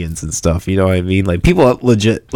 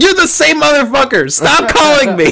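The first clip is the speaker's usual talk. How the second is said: very high, strained mock outburst; retold pissed-off yell. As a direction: re-enact losing it at a scam caller. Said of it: "I got really pissed".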